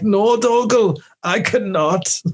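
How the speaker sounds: high, slow mock hero; weak-sounding Connor. Connor answers Dougal, parodied as weak-sounding. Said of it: "he's just so weak, weak sounding".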